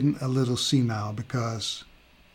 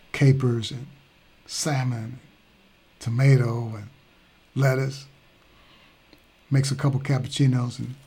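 ordinary talk

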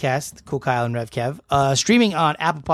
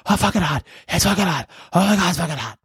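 higher-pitched retold suffering; mock panic; 5 semitones higher, faster than usual. Retold panic at burning chip.

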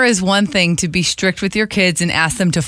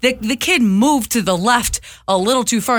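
ordinary delivery

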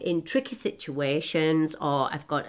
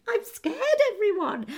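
high laughing admission of fear; laughing scared. Real, not acted. Confessing fear of dating to the audience, high laughing squeal.

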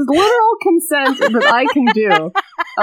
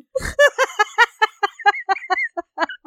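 high helpless cackle; real helpless laughing fit; hard laughter; 10 semitones higher, 3 dB quieter than usual. Real, not acted. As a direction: laugh until you can't breathe. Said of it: "laughing so hard".

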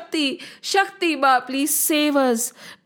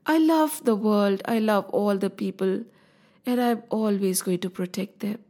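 tender Mother Shakti; 3 semitones lower than usual. Acted. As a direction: soothe frightened children as a mother. Mother Shakti comforts her children.

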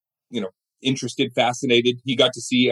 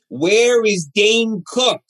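put-on much higher, very slow old comedian's voice; Jerry Lewis impression; 9 semitones higher, 3 dB louder, much slower than usual. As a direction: drag it out like an old showman at the podium. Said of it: "the Jerry Lewis voice".

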